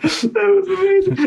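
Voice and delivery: high, drawn-out squeal through laughter; laughing. Real, not acted. Storyteller cracking up over the hostel tale, laughing. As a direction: squeeze it out while cracking up.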